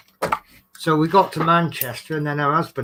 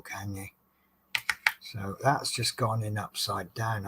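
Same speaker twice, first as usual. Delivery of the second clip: ordinary talk, nothing marked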